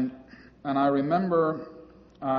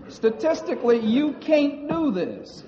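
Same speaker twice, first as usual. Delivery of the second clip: imitating her Southern accent, pitched higher; 12 semitones higher, 4 dB louder than usual. Instructor telling him he can't, in a mock Southern accent. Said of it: "she said in her southern accent".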